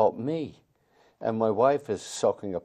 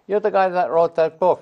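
a higher, louder New York passer-by; street fan; 10 semitones higher, 11 dB louder than usual. Retold or imitated. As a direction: play the stranger who recognizes him. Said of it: "You see these characters in the streets".

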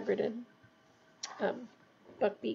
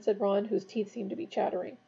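ordinary delivery, nothing marked

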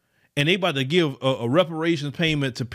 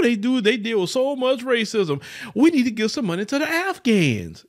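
high, sing-song mock pity; mocking fake sympathy; 8 semitones higher than usual. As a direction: ham up the hollow concern of a pandering politician. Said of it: "Every time you catch them doing that".

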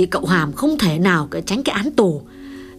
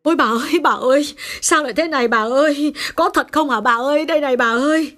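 alarmed maid crying out, pitched up; high-pitched wail of dismay; startled cry; 4 semitones higher than usual. Acted. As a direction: call out in shock, high and urgent. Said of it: "chị người làm giật mình thảng thốt".

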